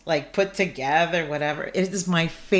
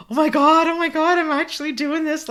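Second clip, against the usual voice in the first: much higher and louder, breathless disbelief; overwhelmed excitement; 9 semitones higher, 4 dB louder than usual. Retold or imitated. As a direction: gush it like you can't believe it's happening. Bawling on plane, first trip.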